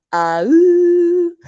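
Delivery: loud high laughing gasp. Real, not acted. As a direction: squeal of laughter. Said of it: "This is me laughing".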